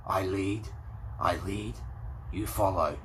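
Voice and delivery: hint of a grim leader giving orders; tough leader line. Hunter tells his crew he leads, lightly voiced low command. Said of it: "his best line in the film".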